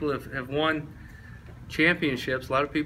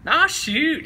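high, loud dismissive coach; coach impression; 13 semitones higher, 7 dB louder than usual. Coach waving off the player's warning, high and loud imitation. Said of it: "he just thinks he can win everybody over".

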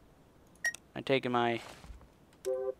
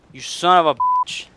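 angry yell; 5 semitones higher, 10 dB louder than usual. Real, not acted. Raging at game.

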